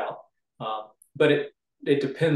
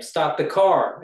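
louder, slower exclamation; exclamation point; 3 semitones higher, 5 dB louder, slower than usual. Shown as a demonstration. Editor demonstrating one exclamation point, raised-voice exclamation. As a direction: raise the voice, stretching it slightly. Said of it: "exclamation point".

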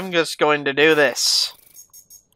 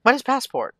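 entrant voice; 6 semitones higher, 4 dB quieter than usual. Acted.

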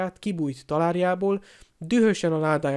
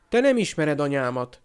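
plain reading voice

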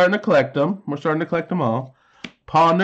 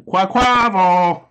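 excited drawn-out call; 4 semitones higher, 3 dB louder, slower than usual. Real, not acted. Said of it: "I get excited by figuring things out".

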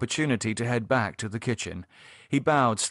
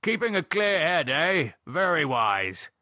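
high start, slowing to approval; hearty, knowing gentleman's voice; jovial Gerald; 6 semitones higher than usual. Acted. Gerald approving David's glass of water, jovial.

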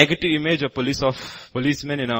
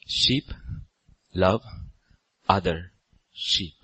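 ordinary talk, nothing marked

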